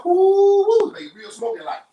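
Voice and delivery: high, loud whooping cheer; excited whoop. Real, not acted. Cook adding liquid smoke for viewers, excited high whoop. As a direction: whoop like it's a party in the pan.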